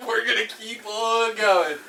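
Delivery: high, slow, laughing through it. Retold or imitated. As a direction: drag it out while cracking up. Own retold reply, laughing.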